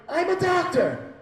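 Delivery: high, loud, drawn-out shout; urgent announcement. Retold or imitated. Comic jumps up on the plane to volunteer, urgent shouting. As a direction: shout it heroically across the cabin.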